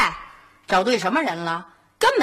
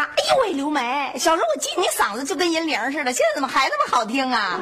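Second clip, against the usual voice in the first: high-pitched effusive greeting, imitating an old classmate; mock-gushing reunion squeal; 4 semitones higher than usual. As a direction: lay on excited flattery, pitched up. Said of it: "一上来先夸".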